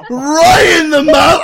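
high, loud, drawn-out cry of disgust; appalled shout. Real, not acted. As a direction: shriek it slowly in real horrified hilarity. Reacting in horror to vomit story.

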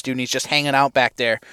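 ordinary talk

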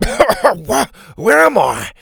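high, loud, sputtering question; coughing confusion. A narrator's reading. Doctor Rex wakes after being splashed, dazed sputter. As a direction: come round spluttering and lost. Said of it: "he coughed".

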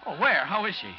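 loud urgent questions; eager. Acted. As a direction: press for news, worried. News of Lois found.

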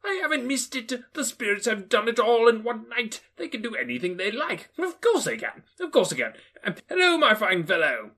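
high, eager; happy Scrooge. Acted. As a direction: chuckle to himself then hail the boy. Scrooge overjoyed.

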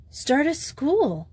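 "Start a school" said slow and high, a bold reveal; startling announcement. A narrator's reading. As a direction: drop "Start a school" as a surprise, slowly and up high. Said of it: "the sufficiently startling reply".

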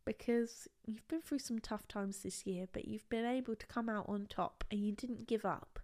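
soft, low, earnest encouragement; sincere praise. Real, not acted. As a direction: tell them quietly and tenderly. Sincere praise after being asked.

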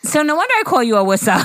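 Awissa said with a W for the L; laughing, Alyssa said as Awissa. Real, not acted. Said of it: "Awissa? You mean Alyssa?".

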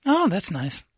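squeaky tattletale imitation; high prissy woman voice.

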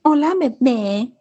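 high, drawn-out cutesy phone greeting; mock her sickly-sweet baby talk; exaggerated, stretched-out girly imitation; annoying girlfriend. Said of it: "Aida being fucking annoying".

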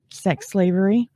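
ordinary talk, nothing marked

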